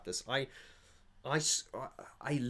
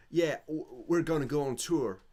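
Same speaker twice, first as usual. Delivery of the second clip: louder Essex-American Dave voice; Essex American accent; 5 semitones higher, 5 dB louder than usual. Retold or imitated. Fan imitating Dave announcing tour, Essex American accent. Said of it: "Essex American accent".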